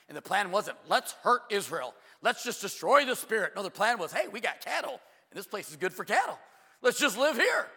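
loud, high, impassioned sermon delivery; full-force preaching. Real, not acted. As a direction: drive it home at the top of your voice.

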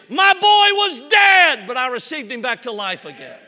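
joyful shout. Retold or imitated. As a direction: cry it out as if she can't hold it in. Little lady shouting her testimony.

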